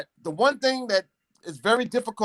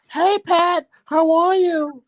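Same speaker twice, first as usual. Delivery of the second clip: much higher, mock girly greeting; high fake woman voice; 12 semitones higher, 4 dB louder, much slower than usual. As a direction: put on a high feminine voice. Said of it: "They'd be like".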